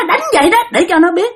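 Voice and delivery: high spirit voice giving orders; possessed spirit. Retold or imitated. Spirit orders a beating to teach her, high-pitched. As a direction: command in an eerie high pitch.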